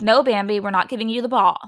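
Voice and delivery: loud, firm refusal to a dog; mock scolding. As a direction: imitate someone telling the dog off loudly. Acts out Erica scolding the dog.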